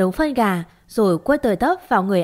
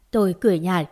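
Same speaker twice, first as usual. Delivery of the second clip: plain reading voice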